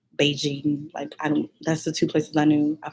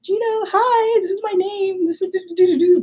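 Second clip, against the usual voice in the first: imitating her nervous self; 14 semitones higher than usual. To the contest audience, a nervous high-pitched learner's speech. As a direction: squeak it out like a shy first-year student. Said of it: "two minutes of me being like".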